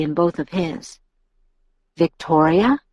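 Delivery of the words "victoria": "Victoria" with a sharp final rise; questioning. A narrator's reading. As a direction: ask "Victoria", gliding up at the end. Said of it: "Carlyle asked".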